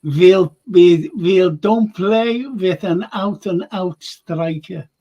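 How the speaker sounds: slow, bad German accent; hammed-up German manager impression; Jürgen Klopp. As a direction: put on a laboured German football-manager accent. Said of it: "that was a terrible Jürgen impression".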